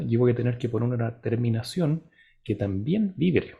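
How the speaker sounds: ordinary talk, nothing marked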